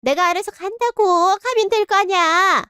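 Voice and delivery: high, indignant retort; mother snapping while being put out of the room; huffy snap. Acted. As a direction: bite back as you storm off. Mother leaves in a huff.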